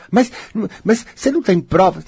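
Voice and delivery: high skeptical inner voice; doubting inner voice. Acted. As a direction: play the nagging doubt that questions you. Doubting inner voice challenges speaker.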